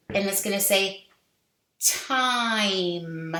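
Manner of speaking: normal talking voice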